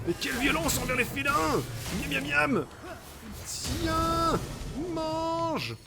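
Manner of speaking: high cartoonish voice offering food to a cat; cutesy pet voice. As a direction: coo at the cat like a toddler. Voicing the cat, feeding it.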